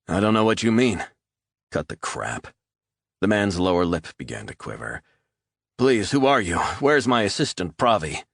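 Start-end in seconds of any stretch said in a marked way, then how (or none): 0.1-1.0 higher, louder rattled denial; nervous denial. Acted. Captive man lying to gunman, higher and nervous. 1.7-2.5 lower, clipped-off threat; hard cold order. Acted. Resnick silencing the captive, low and cold. 5.8-8.2 higher, louder panicky plea; beg, voice climbing in fear; frightened pleading. Acted. Terrified man at gunpoint pleading with intruder, higher and louder. Gun on man, lip quivering.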